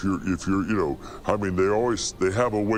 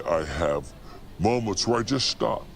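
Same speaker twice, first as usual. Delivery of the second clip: ordinary delivery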